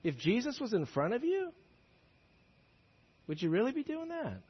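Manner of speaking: quietly pressing the congregation; hushed challenge. Real, not acted. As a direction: drop the voice to confront them. Pointed rhetorical question to congregation.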